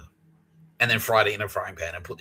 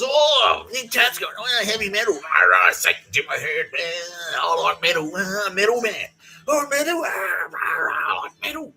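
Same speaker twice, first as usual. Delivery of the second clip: put-on loud, high, slurring drunk calling out; drunken yell; 10 semitones higher, 6 dB louder, slower than usual. The drunk housemate bursting in about metal, high and yelling. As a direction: stretch the last words like a drunk bellowing.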